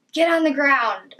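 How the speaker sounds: retold police shout. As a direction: imitate an officer calling out an order. Retold police command overheard outside.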